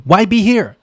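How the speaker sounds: ordinary talk, nothing marked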